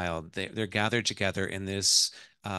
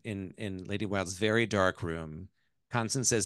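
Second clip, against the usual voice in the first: normal talking voice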